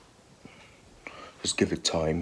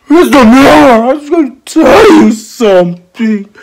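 whiny pupil; 9 semitones higher, 7 dB louder than usual. Acted. Pupil pestering busy teacher.

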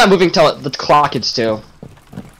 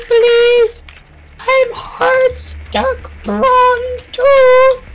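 put-on high, slow whiny child begging; whiny kid voice; much slower than usual. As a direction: beg like a little kid.

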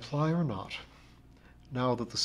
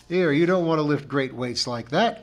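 louder, raised protest; crying out; 4 semitones higher, 7 dB louder than usual. A narrator's reading. Mister Willett warns against lifting great weights, crying out. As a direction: cry out a concerned warning. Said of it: "cried Mister Willett".

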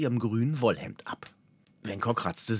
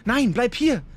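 high, loud urgent plea; hasty, alarmed; 13 semitones higher, 6 dB louder than usual. A narrator's reading. Elf woman holding companion back from the forest, hasty and alarmed. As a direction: stop him urgently, voice jumping up. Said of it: "sagte Laria hastig".

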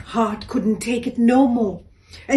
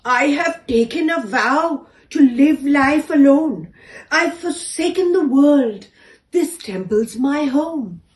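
proud elephant; 5 semitones higher, 6 dB louder than usual. A narrator's reading. Elephant explains her vow.

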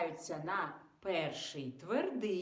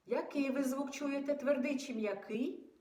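ordinary delivery

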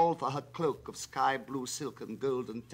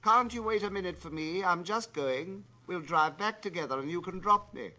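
flung out call; 7 semitones higher, 5 dB louder than usual. Acted. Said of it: "which Odette flung out after him".

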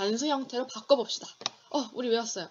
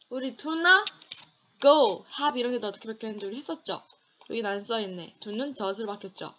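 ordinary delivery

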